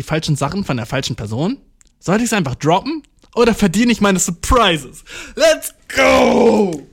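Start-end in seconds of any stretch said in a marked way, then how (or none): 5.4-6.9 loud, high hype cry; excited shout. Real, not acted. Podcast host launching into a listener's question, excited shout. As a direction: belt it out as a drawn-out rallying cry.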